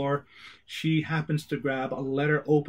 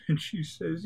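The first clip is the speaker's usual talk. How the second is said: ordinary delivery, nothing marked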